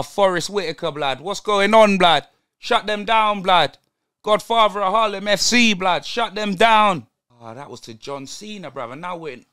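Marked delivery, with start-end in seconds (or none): none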